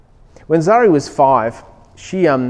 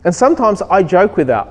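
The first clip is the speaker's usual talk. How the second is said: ordinary delivery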